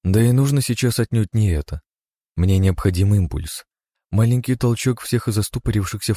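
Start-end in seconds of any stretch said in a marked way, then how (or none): none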